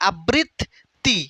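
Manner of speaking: আবৃত্তি stretched out very slowly, the doubled ত্ত held; slow model word. Shown as a demonstration. Teacher models আবৃত্তি for students, very slow. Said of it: "প্রথম দুইটা letter ঠিক আছে".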